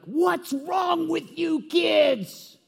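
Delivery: put-on high, slow, loud shout at unruly kids; retold shout. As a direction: bellow it out, dragging each word. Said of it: "she would shout things like".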